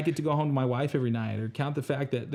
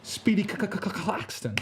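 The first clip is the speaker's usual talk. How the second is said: mock stutter; 7 semitones higher than usual. Stutter joke continued.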